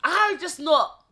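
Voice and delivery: high, loud protest; loud insisting. Real, not acted. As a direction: shout your innocence, high and loud. Insisting against his disbelief.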